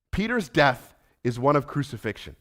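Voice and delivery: ordinary delivery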